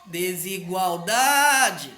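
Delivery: de-si-gual-DAAA-de, DA alto e esticado; penúltima sílaba forte. Shown as a demonstration. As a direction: chame Desigualdade demorando no DA. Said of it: "a penúltima sílaba é a mais forte". (Desigualdade com DA forte e demorado)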